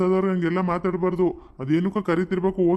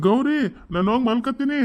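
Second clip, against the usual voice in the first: calling out; 6 semitones higher than usual. Acted. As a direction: call it out higher to the wife in the kitchen. Calling to wife in another room.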